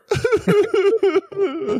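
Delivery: high-pitched cackle; high squealing laugh. Real, not acted. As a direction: burst into a shrill laugh. Laughing at the crier bit.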